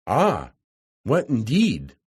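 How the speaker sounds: usual speaking voice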